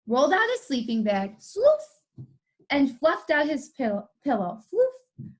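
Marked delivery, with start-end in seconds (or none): 1.4-2.2 squeaky high sloof effect; high sloof sound. Acted. Reader voicing the sleeping bag, squeaky high. 4.7-5.3 squeaky floof effect; high floof sound. Acted. Reader voicing the pillow, squeaky high.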